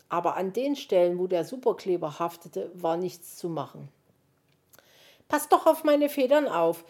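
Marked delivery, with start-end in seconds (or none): 5.3-6.8 high, loud lament; wailing complaint. A narrator's reading. Magpie's feathers are being pulled, loudly wailing. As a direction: whine in protest, pitched up. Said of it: "jammerte die Elster".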